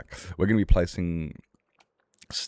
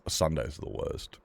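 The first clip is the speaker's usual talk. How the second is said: normal talking voice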